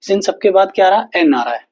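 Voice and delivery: ordinary delivery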